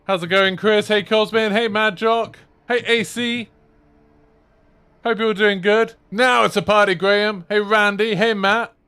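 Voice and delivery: high, loud greeting call; streamer shout-out. Acted. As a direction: call out each viewer's name high and loud. Greets viewers as they arrive.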